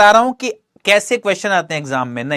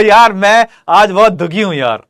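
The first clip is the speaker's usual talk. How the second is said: louder, cheerful read of a sad line; mismatched sad line; 6 dB louder than usual. Shown as a demonstration. Teacher demonstrating a mismatch between words and tone. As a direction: say it sad words with a happy laugh. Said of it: "ऐसा ये भी match नहीं करा".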